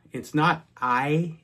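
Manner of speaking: ordinary delivery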